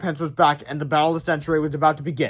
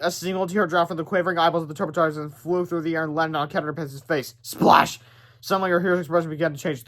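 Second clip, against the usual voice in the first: ordinary delivery, nothing marked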